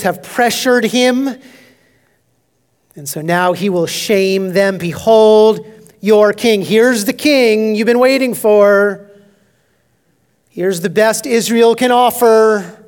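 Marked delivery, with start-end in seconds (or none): 4.8-9.1 slow, louder mocking announcement; mocking sarcasm. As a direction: shame them with a drawn-out sneer. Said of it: "It's sarcasm, it's mockery".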